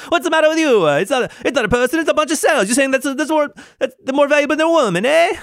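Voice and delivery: very high, loud cartoon Italian accent; mock Italian accent. Imagined opponent arguing about abortion, over-the-top mock Italian accent. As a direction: argue in a hammed-up Italian accent, pitched sky-high. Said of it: "this person I'm talking to is Italian now".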